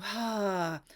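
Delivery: usual speaking voice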